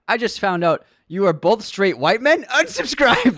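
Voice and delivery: high, loud indignant read building to a yell; comic scandalized shout; mock outrage. Host reading a joke complaint to co-host, mock-outraged shout.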